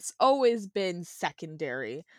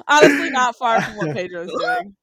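laughing; 4 semitones higher, 10 dB louder than usual. Real, not acted. Laughing at Pedro's accent.

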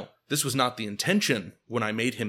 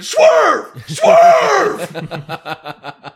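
full-throated shout, much higher and louder; screaming; 16 dB louder than usual. Retold or imitated. Hanging out the window screaming at the driver to swerve.

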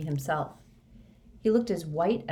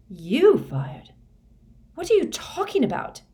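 loud, high-pitched incredulity; shocked questioning; 8 semitones higher, 6 dB louder than usual. Acted. Harry challenges Moody's confession, shocked. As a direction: burst out in high, baffled shock.

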